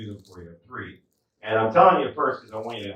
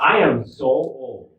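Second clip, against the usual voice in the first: slow, loud proclamation; call-and-response setup; 6 dB louder, slower than usual. Acted. Comic cues audience to shout back, slow loud declaration. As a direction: stretch each word to cue a response.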